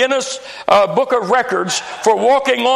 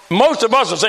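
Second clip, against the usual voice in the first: ordinary talk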